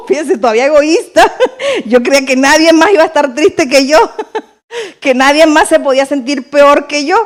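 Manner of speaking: chuckling through the words at her own selfishness; laughing at herself. Real, not acted. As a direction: laugh through it, amused at yourself.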